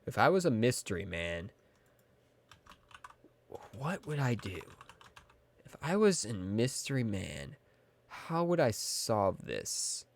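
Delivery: soft, musing, hesitant; pondering sleuth. Pretend. Streamer wonders how to solve the mystery, soft pondering voice. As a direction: think aloud quietly with long pauses.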